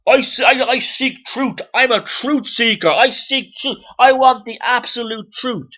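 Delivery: high, loud mock Ulster zealot; Ian Paisley. Mocking people who seek absolute truth, high ranting impersonation. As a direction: declaim it as a hammed-up Ulster truth seeker. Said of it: "You sound like fucking Ian Paisley".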